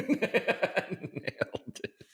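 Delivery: breathy laughing repeat; wheezing laugh through the words. Real, not acted. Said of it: "[name] says, okay, nailed it".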